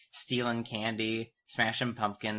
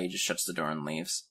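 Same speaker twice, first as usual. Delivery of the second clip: ordinary delivery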